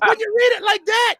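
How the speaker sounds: high, loud comic outrage; shouted mock protest. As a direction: yell it like an indignant objection in court. Comedian's acted courtroom protest.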